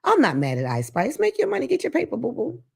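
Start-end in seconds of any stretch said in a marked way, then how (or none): 1.2-2.6 playful sass. Acted. Playful nod to [name].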